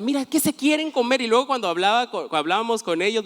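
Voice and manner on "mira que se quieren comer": much higher, teasing warning; mock pastor Tere. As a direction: mimic the pastor insisting, pitched way up. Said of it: "Y me decía". (imitando a la pastora Tere, voz muy aguda)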